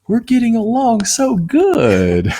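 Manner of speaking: high, drawn-out mock-sweet voice; imitating partner. Husband quoting what his wife said last week, high mock voice. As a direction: draw it out slowly in a teasing high pitch. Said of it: "you're like".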